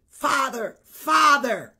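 loud, pleading calls of Father; mock fervent calling. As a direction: ham up a zealot crying out to the Father. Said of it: "they were very".

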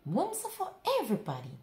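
fast, loud outcry; crying out. A narrator's reading. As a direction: protest it all together. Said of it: "they cried".

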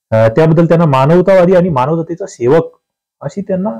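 normal talking voice

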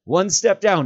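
high, loud call from below. Acted. Frodo calling up to Sam from a ledge below. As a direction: shout up the cliff to Sam.

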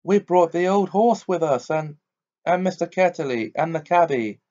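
Polly crying out at the wood; cried out. Acted. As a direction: call out in surprise. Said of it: "Polly cried out".

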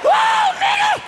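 sudden high yelp of alarm at a driver; startled yell. Acted. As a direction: jump in the seat and yelp.

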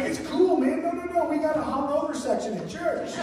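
high-pitched, excited invitation; eager reassurance. Retold or imitated. Pastor retelling his invite to the hungover woman, eager reassurance. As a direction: wave the worry away, high and eager.

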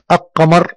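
"القمر" as aq-qamar, l dropped and q doubled; wrong silent lam. Shown as a demonstration. Teacher warns of the mistake, l dropped in "القمر". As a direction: mispronounce "القمر" by skipping the l into a double q. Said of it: "إذا قلت كلمة القمر خطأ".

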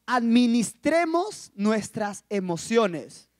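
very slow, deliberate quote; measured advice. Retold or imitated. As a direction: space out each word solemnly. Quotes her solemn, odd phrase.

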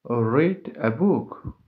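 said slowly and carefully, every word clear; slow it down so learners can follow; slow model reading. Shown as a demonstration. Teacher reads the example slowly for learners. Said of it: "For example".

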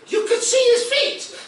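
high-pitched, loud comic shriek; incredulous outburst. Acted. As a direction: shriek it in mock outrage at the absurdity. Comic outburst over a ridiculous detail.

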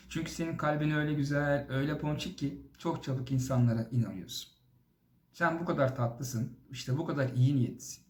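ordinary talk, nothing marked

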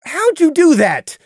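loud, high, slow demand. A narrator's reading. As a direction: demand an answer. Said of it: "Scenic demanded".

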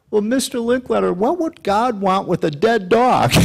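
put-on high, slow, innocent child voice; little boy voice. The boy asks the host, high and puzzled. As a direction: pitch way up like a puzzled kid. Voicing the boy's punchline.

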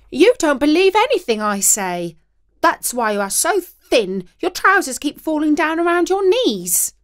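angry wife. A narrator's reading. Wife shouting at husband.

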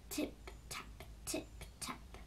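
very soft, whispered tip-taps; light tiptoe steps. A narrator's reading. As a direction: whisper the tiny hoofsteps. Baby goat's steps crossing the bridge.

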